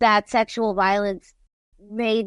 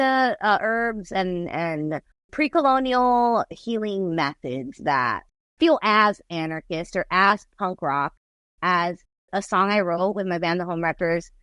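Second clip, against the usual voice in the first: ordinary talk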